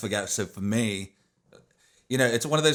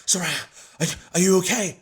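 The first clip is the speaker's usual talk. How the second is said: 1 worried fuss; 8 semitones higher, 6 dB louder than usual. Retold or imitated. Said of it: "everybody's running over to you, going".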